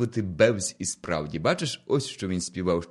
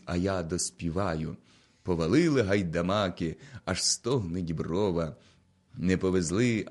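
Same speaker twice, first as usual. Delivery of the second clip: ordinary delivery, nothing marked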